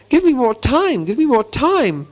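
high-pitched panicked pleading; desperate plea. A narrator's reading. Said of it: "He wanted to shout out".

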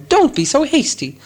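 high-pitched squeaky protest; squeaking. A narrator's reading. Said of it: "squeaked Bilbo".

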